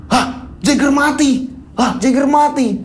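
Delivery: high shocked gasp of a question; stunned that his rooster died; Hendy can't believe his rooster died; shocked disbelief. Acted. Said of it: "Hendy kaget".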